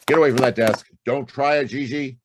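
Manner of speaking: high, loud command; sharp warning. Acted. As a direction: bark it to stop her hand. Warning her away from desk.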